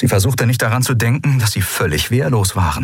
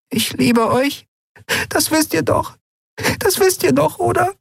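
very high, frail little voice; fragile voice. A narrator's reading. Frightened Jadi tells friends she loves them, fragile. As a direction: say it high and fragile, seeking reassurance. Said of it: "sagte mit zerbrechlicher Stimme".

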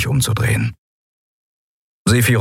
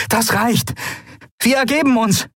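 calling surrender. Acted. Endriel surrenders mid-fight.

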